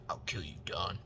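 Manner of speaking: low, soft threatening little shrimp; retold threat. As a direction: play a tiny creature making a hushed, low threat.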